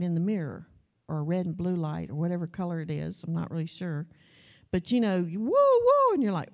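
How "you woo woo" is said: high, loud police siren wail; siren imitation. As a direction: wail like a cop car behind you.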